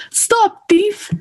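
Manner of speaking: high-pitched call after the rabbit. Acted. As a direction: cry out to stop the thief, pitch up high. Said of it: "calling out".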